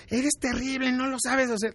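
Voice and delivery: mock scolding. Harsh criticism acted out.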